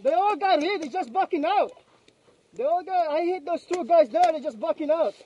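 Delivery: shouting. Real, not acted. Excited callouts mid firefight.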